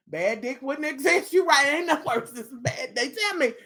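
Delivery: normal talking voice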